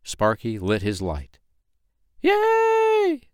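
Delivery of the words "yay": long, very high cheer; excited yell. A narrator's reading. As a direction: cheer like a tiny delighted crowd. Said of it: "they yelled".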